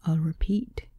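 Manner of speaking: whispered into an ear; soft whisper in the ear. A narrator's reading. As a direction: breathe it close to his ear. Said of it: "nestled his mouth by my ear".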